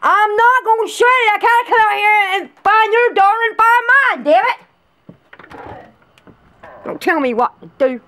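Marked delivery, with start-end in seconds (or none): 0.0-4.6 high, loud, furious yelling back; angry shout. Acted. Arguing with him about the doors, shouted angrily. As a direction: refuse him at the top of your voice.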